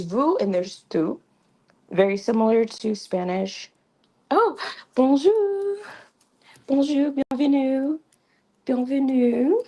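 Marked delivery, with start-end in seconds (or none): 4.3-9.6 happy greeting. Real, not acted. Greets a newcomer on stream.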